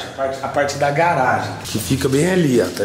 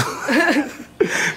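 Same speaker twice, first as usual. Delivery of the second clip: burst of laughter; 10 semitones higher than usual. Real, not acted. Laughs at the joke.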